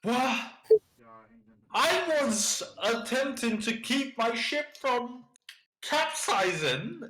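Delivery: a flustered captain protesting his ship; indignant captain. Acted.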